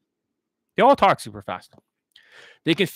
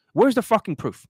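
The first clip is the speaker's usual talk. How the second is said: demands the proof again, higher and louder; 6 semitones higher, 3 dB louder than usual. Real, not acted. Coach ranting at viewers, demanding proof, higher and louder. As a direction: hammer the question at the audience.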